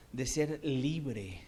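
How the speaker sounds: very slow "de ser libre"; drawn-out emphasis. Real, not acted. Preacher lingering on "libre" for the church. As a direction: stretch "libre" out. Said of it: "slowed emphasis on freedom".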